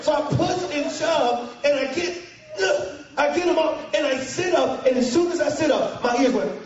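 usual speaking voice

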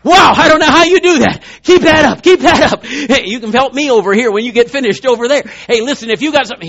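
loud, laughing admiration; impressed coworker. Retold or imitated. Preacher voicing a coworker cheering on the builder, loud and laughing. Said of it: "Or, or".

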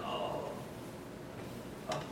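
soft pained groan. Retold or imitated. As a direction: let the grief out in a low moan. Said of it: "Jesus went".

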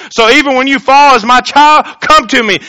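loud, high preaching voice; fervent sermon delivery. Acted. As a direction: preach it out to the congregation. Preaching at full force.

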